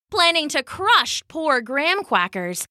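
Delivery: plain reading voice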